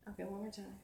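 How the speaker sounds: normal talking voice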